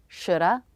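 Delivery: shoulda said as SHOOD-uh, no V; relaxed American shoulda; reduced shoulda. Shown as a demonstration. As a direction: say shoulda with a schwa, dropping have. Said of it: "American English pronunciation video".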